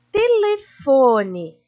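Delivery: "telefone" slowly, with FO drawn out; stress on FO. Shown as a demonstration. Teacher presenting the example word to pupils, "telefone" with a lengthened FO. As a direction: sound out "telefone", leaning on the third syllable FO. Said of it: "Foi a sílaba que eu mais demorei pra falar".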